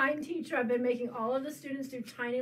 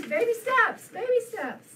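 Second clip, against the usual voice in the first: excited; 9 semitones higher, 7 dB louder than usual. Real, not acted. Cheering herself on.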